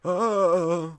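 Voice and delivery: loud, high moan; retold moan. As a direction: let a loud, pained moan slip out. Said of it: "actually moan".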